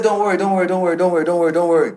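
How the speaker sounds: lightly voiced landlord brushing it off; smooth dismissive landlord.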